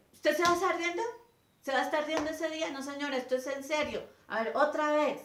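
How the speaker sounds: rapid angry reprimand; teacher scolds a giggling pupil, fast and loud; teacher snapping. Retold or imitated.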